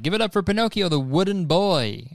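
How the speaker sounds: mock ringmaster introducing the act; lightly hammed-up show introduction; circus announcer. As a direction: announce it like a circus MC, stretching the end. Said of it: "and they're like".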